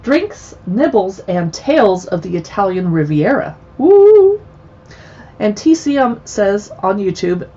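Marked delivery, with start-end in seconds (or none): 3.8-4.4 loud, high, drawn-out "woo"; excited whoop. Real, not acted. Host cheering the Italian Riviera book title, excited whoop. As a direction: let out a big high whoop.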